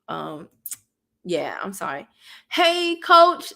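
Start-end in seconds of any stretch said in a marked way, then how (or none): 2.5-3.5 loud, high hello; excited greeting. Real, not acted. Greeting her big brother Coach in chat, loudly excited. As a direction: call out to your big brother.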